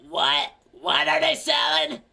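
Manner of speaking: put-on loud, high old-lady yell. Hard-of-hearing old lady asking her daughter, loud high old voice. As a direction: holler it like someone hard of hearing. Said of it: "lady's like".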